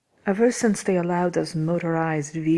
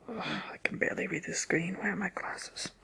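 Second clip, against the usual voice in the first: soft, quick witch's grumble; hushed fussing aside; grumbling to oneself; 4 semitones higher, 10 dB quieter than usual. Acted. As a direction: mutter it under your breath, half whispered. Witch grumbles to herself over screen.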